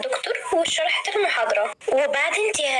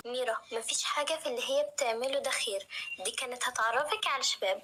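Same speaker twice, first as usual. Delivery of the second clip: hushed warning; 11 dB quieter than usual. Acted. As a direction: drop the voice to a warning hush. Nour warns Mira, voice drops.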